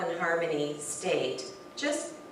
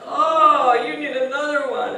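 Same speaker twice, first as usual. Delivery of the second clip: higher, louder offer of a hug; eager offer; 7 semitones higher, 11 dB louder than usual. Retold or imitated. Offering firefighter another hug, higher and louder. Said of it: "And I'm like".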